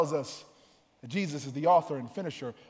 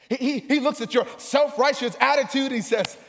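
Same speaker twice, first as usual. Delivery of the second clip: raised, higher sermon voice; full-force preaching; 8 semitones higher, 7 dB louder than usual. Real, not acted. Preacher describing how Jesus sees the self-righteous, full-force preaching. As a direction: surge into it with preaching energy.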